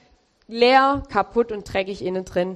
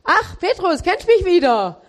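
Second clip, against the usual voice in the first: much higher and louder, a teasing imagined Jesus; mock Jesus voice; 8 semitones higher, 4 dB louder than usual. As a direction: play Jesus gently needling Peter, pitched high. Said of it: "Jesus hätte ja tausend Fragen fragen können".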